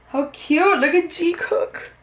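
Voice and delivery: high, loud, breaking with emotion; fangirl squeal. Real, not acted. Fan squealing at a photo of her favourite pair. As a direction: overcome by a cute photo.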